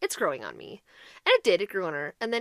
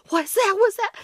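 panicked what's that; 11 semitones higher, 7 dB louder than usual. Retold or imitated. Retelling a panicked night wakeup.

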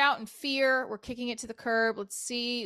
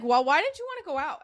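mock indignant; 6 semitones higher, 6 dB louder than usual. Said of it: "Kind of like".

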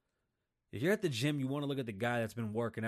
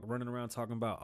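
ordinary delivery

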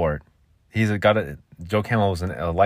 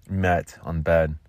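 usual speaking voice

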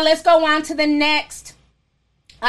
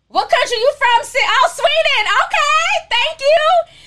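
excited; 14 semitones higher, 4 dB louder than usual. Real, not acted. Surprised by a Swedish donation.

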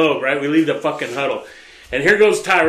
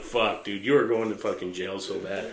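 hushed; 8 semitones lower, 9 dB quieter than usual. Retold or imitated. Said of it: "I'm like".

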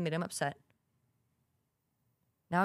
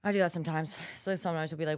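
normal talking voice

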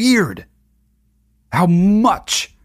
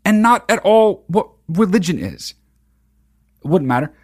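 ordinary talk

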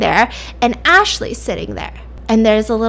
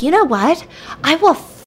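rising, higher-pitched mock outrage; so mad; 6 semitones higher than usual. Darby learns of Jay's hurt hand, so mad. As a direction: act out a furious vow, pitch climbing. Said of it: "Darby's so mad".

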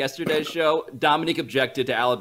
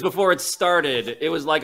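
ordinary talk

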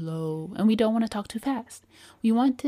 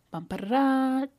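vocal drumroll; 6 semitones higher, 3 dB louder than usual. Acted. Teasing reveal of next point.

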